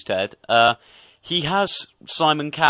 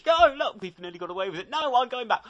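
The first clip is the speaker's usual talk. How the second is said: retold squabble; 11 semitones higher than usual. Retold argument in mimicked voices.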